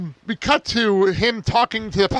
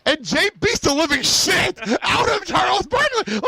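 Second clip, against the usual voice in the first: excited; 11 semitones higher than usual. Real, not acted. Excitedly retelling the beating.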